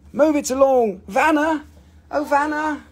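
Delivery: high-pitched summons to a helper; singsong high call for assistance. Real, not acted. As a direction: call across the room in a lifted voice. Calling helper Vanna over.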